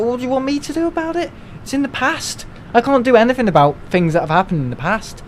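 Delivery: retold reply to a pupil, pitched well up and exasperated; a hammed-up high-pitched what-do-you-want-me-to-do; exasperated retort. Teacher retelling his reply to a moaning pupil, high exasperated voice. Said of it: "I was just like".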